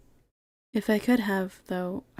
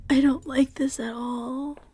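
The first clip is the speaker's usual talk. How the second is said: slow, higher-pitched unease; uneasy dread; 6 semitones higher than usual. Real, not acted. Player dreading what lies ahead in the game, uneasy. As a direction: draw it out in nervous reluctance.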